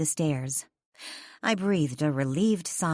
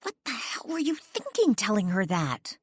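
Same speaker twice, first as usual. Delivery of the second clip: furious hiss; 5 semitones higher than usual. A narrator's reading. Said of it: "hissed furiously".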